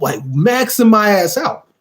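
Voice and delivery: usual speaking voice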